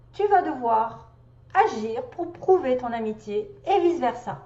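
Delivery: emphatic recital with pauses between phrases; measured, emphatic read-aloud; reading a message aloud. Acted. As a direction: deliver each phrase as a pronouncement. Reads out the message.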